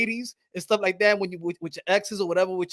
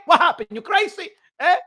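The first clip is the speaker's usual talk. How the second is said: ordinary delivery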